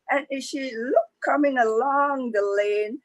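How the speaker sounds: usual speaking voice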